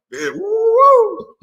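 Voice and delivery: high, slow drawn-out whoops; whooping. Real, not acted. Gloating at a rival, he whoops in triumph. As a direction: let out a gleeful whoop.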